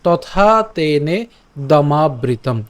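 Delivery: তথা তেনে দমাবৃতম said slowly word by word; slow pronunciation. Shown as a demonstration. Teacher reading the last line slowly. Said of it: "বাকি থাকবে ত".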